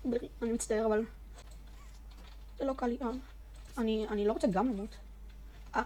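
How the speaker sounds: usual speaking voice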